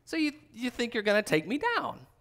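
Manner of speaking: put-on like watching kids play-fight; God amused. God mocking the nations who plot against him, amused.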